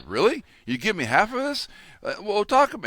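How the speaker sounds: high, pleading barter; man haggling with the angel of death, high and eager; eager bargaining. Retold or imitated. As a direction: pitch it up like a desperate salesman.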